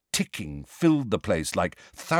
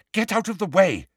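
high, sharp order; 10 semitones higher than usual. A narrator's reading. Hermione to the boys at the locked door, sharp. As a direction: sharply order them aside. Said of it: "said Hermione sharply".

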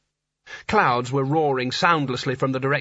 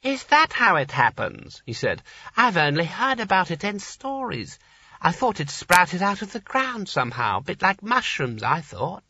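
a lightly voiced old man, higher, in delighted wonder; delighted old Creosote; 5 semitones higher than usual. A narrator's reading. Creosote marvels at the snow, lightly voiced delighted old man. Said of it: "watched in delighted astonishment".

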